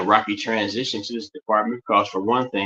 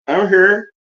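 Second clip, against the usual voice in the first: excited exclamation; 8 semitones higher, 6 dB louder than usual. Acted. Playfully insisting he is present.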